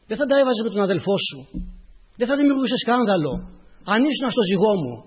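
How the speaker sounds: earnest admonishing. Acted. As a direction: admonish as the Lord to a child. Preacher voices the Lord admonishing.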